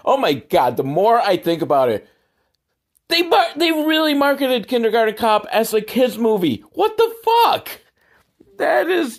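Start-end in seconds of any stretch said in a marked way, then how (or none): 3.1-9.2 incredulous outburst. Real, not acted. Outraged at kids' movie marketing.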